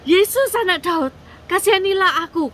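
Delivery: high, loud acted cry for mercy; pleading yell, pitched well up; loud shout. A narrator's reading. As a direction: call out hard to someone passing by. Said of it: "dia teriak dengan keras".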